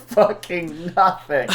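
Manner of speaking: high, slow words through real laughter; laughing. Real, not acted. Co-host mocking the timeline device, laughing. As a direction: crack up while saying it.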